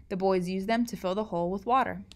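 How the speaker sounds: usual speaking voice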